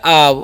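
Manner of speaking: "आव" as the sound of OU; teacher's model of "आव"; demonstrated pronunciation. Shown as a demonstration. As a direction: say "आव" with long आ gliding into व. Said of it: "उसका उच्चारण यहाँ पर आव हो जाता है".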